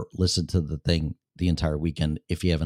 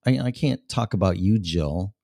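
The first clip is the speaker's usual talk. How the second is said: ordinary delivery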